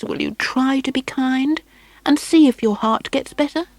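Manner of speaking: put-on a mother begging her child; pleading mother.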